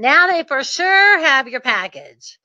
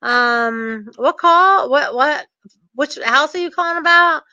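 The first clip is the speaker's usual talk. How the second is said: feigned confusion, pitched up; acting dumb; 3 semitones higher than usual. Sender stalling the bank's caller, acting dumb. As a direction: play innocent, as if clueless. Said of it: "so I act dumb".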